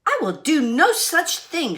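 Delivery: snapping back. Acted. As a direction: snap back defiantly. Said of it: "she snapped".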